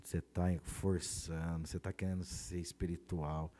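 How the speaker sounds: low, soft, slow inner voice; soft accusing voice. Retold or imitated. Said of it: "E veio aquela voz".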